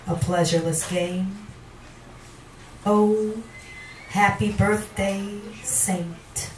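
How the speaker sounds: poetry reading. Acted. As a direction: swell on the warnings, then fall away. Reads a poem aloud.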